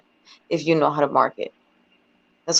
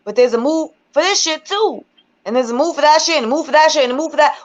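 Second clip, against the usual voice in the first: pitched way up, fired-up venting; heated rant; 7 semitones higher than usual. Real, not acted. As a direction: get worked up and let it fly high. Heated rant about judging women.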